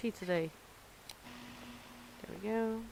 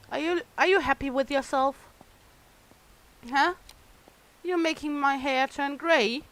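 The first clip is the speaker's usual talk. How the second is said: louder, faster, higher telling-off; mock scolding; 3 semitones higher, 5 dB louder than usual. Shopkeeper nagging an impatient customer, mock angry.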